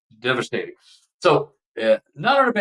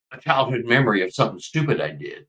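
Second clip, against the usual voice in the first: normal talking voice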